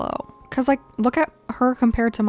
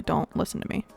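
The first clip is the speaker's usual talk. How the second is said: sheepish whisper; 6 semitones lower, 7 dB quieter than usual. Real, not acted. Sheepish aside after guessing.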